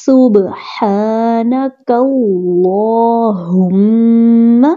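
سبحانک اللہم slowly, ha from the chest, meem held nasal; slow tajweed chant of سبحانک اللہم. Shown as a demonstration. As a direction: let the meem of اللہم hum through the nose. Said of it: "ہا کو چھاتی سے آواز نکلے گی".

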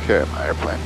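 normal talking voice

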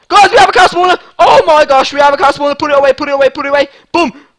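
loud, high, elated yelling; triumphant shout. Real, not acted. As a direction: shout the win to your viewers. Celebrates mining the spawner.

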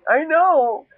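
ordinary delivery

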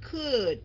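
"could" begun like Vietnamese KH; airy C at the start of "could"; aspirated K. Shown as a demonstration. Teacher applying the KH rule for students, airy "could". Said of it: "cái này đọc là could".

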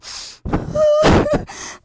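put-on high breath of a child's sob; hammed-up sobbing wail; acted crying. Storyteller as lost boy by the roadside, sobbing. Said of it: "he started to cry".

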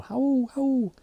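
high hooting owl screech; owl hoots. Retold or imitated. Boomhauer acting out screaming like an owl in the store, high hoots. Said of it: "I started screaming, man, like a damn owl".

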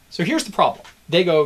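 ordinary delivery

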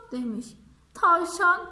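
plain reading voice

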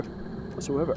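whisper. Real, not acted. Muttered after complaint.

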